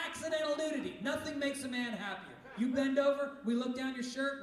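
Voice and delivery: normal talking voice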